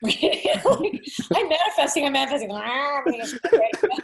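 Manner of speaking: high-pitched, laughing send-up of a would-be manifester; hammed-up high voice, breaking into laughter; mock manifesting. Joking about spiritual narcolepsy at the wheel, high laughing mock voice.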